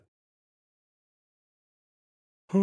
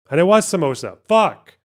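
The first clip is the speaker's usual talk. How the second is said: louder, higher, ending in a curse; annoyed outburst; 5 semitones higher, 8 dB louder than usual. Real, not acted. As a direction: build to a frustrated swear. Reading missed answers, annoyed.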